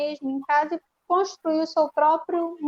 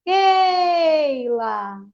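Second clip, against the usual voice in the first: "Keila" with a long, drawn-out "Kei"; stressed syllable stretched; 3 semitones higher, 3 dB louder than usual. Shown as a demonstration. Teacher demonstrating the strong syllable, "Keila" with "Kei" stretched. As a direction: hold "Kei" long, then drop onto "la" in "Keila". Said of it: "Qual vai ser a sílaba mais forte?".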